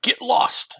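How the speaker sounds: high, loud retold shout; mock angry shout; acted yell. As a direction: yell it out like a comic-book hero. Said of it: "he's awake and yells out".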